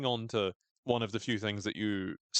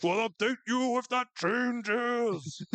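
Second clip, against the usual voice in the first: high, slow comic ghost; Coach Martinez; 13 semitones higher, 3 dB louder, much slower than usual. Acted. Dead coach promising updates, ghostly high wail. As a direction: float the words out in a long wail. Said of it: "I can imagine the ghost of Co-, Coach Martinez going".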